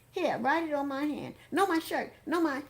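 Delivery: put-on high, eager girlish voice; high-pitched, excited and undecided; excited young girl. A narrator's reading. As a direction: offer it like a flustered teenage fan. Excited girl offering places to sign.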